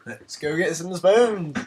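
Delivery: loud, high, drawn-out call; hammed-up enthusiasm; mock-eager rallying cry. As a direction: shout it like setting off on an adventure. Rallies himself for spoon challenge.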